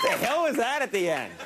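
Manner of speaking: laughing disbelief. Real, not acted. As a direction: crack up while asking. Repeated, higher, laughing.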